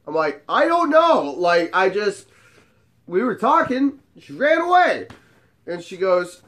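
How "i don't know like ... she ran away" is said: high, louder baffled protest; mock innocence. Man explaining to hot friend, feigned bewilderment. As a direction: plead total ignorance, hammed-up.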